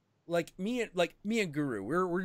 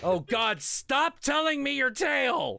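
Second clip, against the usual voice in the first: exasperated plea; 4 dB louder than usual. Retold or imitated. Exasperated at clingy storyteller.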